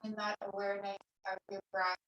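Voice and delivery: soft, unhurried meditation voice with long pauses; guided meditation. Real, not acted. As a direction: guide them gently into stillness, leaving long silences. Leads a seated meditation.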